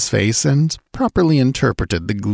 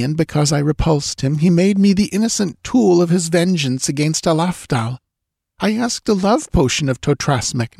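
put-on higher voice, anxious young woman pleading; frightened young woman; 9 semitones higher than usual. A narrator's reading. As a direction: voice the scared dancing girl, pitched up. Girl voiced in story.

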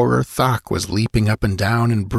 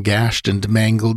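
ordinary delivery

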